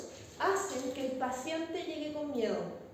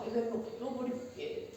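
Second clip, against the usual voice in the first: exasperated near-whisper, softer; weary aside; 3 semitones lower, 6 dB quieter than usual. Retold or imitated. Teacher retelling a fed-up aside to her colleague, exasperated near-whisper. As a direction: sigh it under your breath, fed up.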